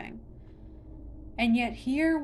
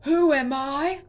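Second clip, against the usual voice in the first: much louder and higher, a thunderous question; roaring monster; 6 semitones higher, 13 dB louder than usual. A narrator's reading. Monster holds Connor aloft, roaring. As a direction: bellow it. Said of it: "still roaring".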